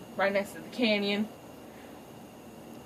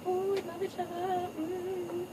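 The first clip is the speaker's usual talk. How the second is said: high, soft, sappy lovers' coo; sugary duet voice mocking a love song; mock lovey-dovey couple; 11 semitones higher, 7 dB quieter, slower than usual. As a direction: coo it like a smitten cartoon couple. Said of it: "they're like".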